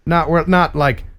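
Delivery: ordinary delivery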